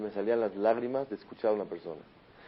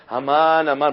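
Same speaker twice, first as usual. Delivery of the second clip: loud, higher shout of the name; crowd calling out; 4 semitones higher, 9 dB louder, slower than usual. Retold or imitated. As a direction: call out the name as the crowd does. Todos golpean el piso por Amán. (grito fuerte del nombre Amán)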